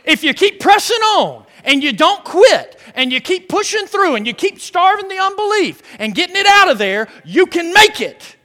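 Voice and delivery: loud, high, hard-driving sermon voice; full-force preaching. Real, not acted. Preacher urging congregation to keep pressing on, full-force preaching. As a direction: build an urgent exhortation, phrase on phrase.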